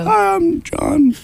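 very high falsetto put-on voice; disguised voice. Host disguising his voice to greet John, falsetto. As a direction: squeak it out to hide who you are. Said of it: "I think I disguised my voice".

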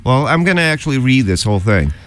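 usual speaking voice

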